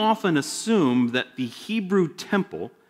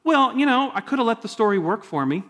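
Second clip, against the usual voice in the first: higher, mock-complaining "Well, you know"; annoyed reader; 8 semitones higher than usual. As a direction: whine like a spoiled reader. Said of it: "And you're like".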